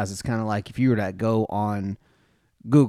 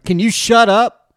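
high, loud, slow angry yell; angry shout; 12 semitones higher, 12 dB louder, slower than usual. Retold or imitated. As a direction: snap at them to shut up. Annoyed golfer yells at camera.

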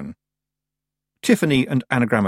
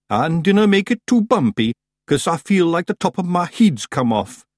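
high, loud Scots character voice; daft Scottish Feegle; 9 semitones higher, 5 dB louder than usual. A narrator's reading. Daft Woolly begs for a smooth flight, Scottish. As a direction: plead comically in broad Scots.

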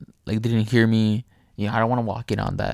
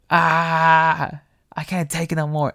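loud, higher wail into a strained plea; agonized cry; 4 semitones higher, 4 dB louder, slower than usual. Retold or imitated. Storyteller mimics unseen man wailing in the bathroom, agonized. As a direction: imitate someone crying out in strain.

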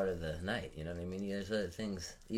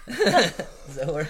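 stoner giggle; 8 semitones higher, 12 dB louder, much slower than usual. Pretend. Silly laughing bit after dubbing joke.

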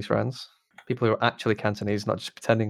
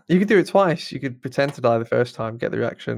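usual speaking voice

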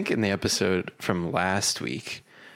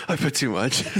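laughing; 5 semitones higher than usual. Real, not acted. Laughing at his own mistake.

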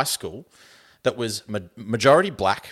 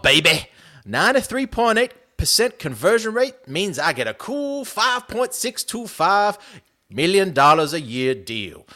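hammed-up Southern drawl with swooping, higher pitch; 5 semitones higher than usual. Kicker boasting about his new contract, cocky Southern drawl. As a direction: brag in a big, swinging Southern drawl. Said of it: "give him the kind of southern drawl".

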